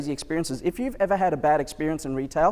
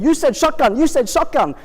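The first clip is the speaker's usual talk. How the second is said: high, loud accusing yell; retold scream; 9 semitones higher, 5 dB louder than usual. Shop clerk screaming at the customer, accusing. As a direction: shout it accusingly. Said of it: "pointing at me and screaming".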